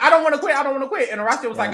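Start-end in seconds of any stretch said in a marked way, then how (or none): 0.0-0.5 higher, louder tearful "I don't wanna quit"; crying plea. Retold or imitated. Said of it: "she starts crying because she's like". 0.6-1.1 softer echo. Retold or imitated. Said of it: "she starts crying because she's like".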